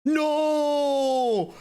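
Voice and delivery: anguished shout. Real, not acted. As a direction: yell it as you lose the fight. Killed in a fight.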